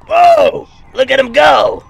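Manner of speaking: high loud amazed cry; surprised shout. Acted. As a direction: yell out in amazement. Friend speeds off on boost.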